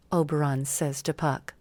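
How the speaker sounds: ordinary delivery, nothing marked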